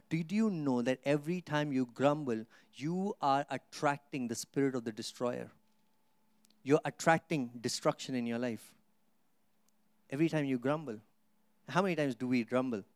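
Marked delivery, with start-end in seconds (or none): none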